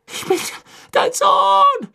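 hoher, lauter Verzweiflungsschrei; Schrei des Sohnes. A narrator's reading. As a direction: schrei zum Vater hoch. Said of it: "schrie er zu Crouch hoch". (hoch und laut verzweifelt geschrien)

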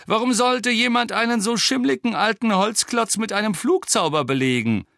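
high, scornful heckling; contemptuous jeer. A narrator's reading. Malfoy heckles Ron about his old broom, contemptuous jeer. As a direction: jeer loudly at the target. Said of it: "rief Malfoy verächtlich".

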